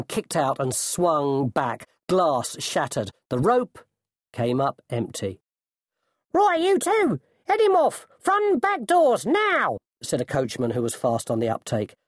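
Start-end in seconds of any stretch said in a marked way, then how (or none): 6.3-9.8 very high, urgent shout; coachman's high-pitched command; shouted orders. A narrator's reading. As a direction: send the men running after a fugitive.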